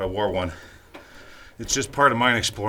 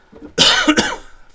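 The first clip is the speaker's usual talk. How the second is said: coughing on dust; 10 dB louder than usual. Real, not acted. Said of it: "hack a lung in the camera".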